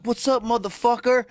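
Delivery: a light take on the stranger picking a fight; mock tough guy. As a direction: call it out like a swaggering street tough. Aggressive stranger voiced in story.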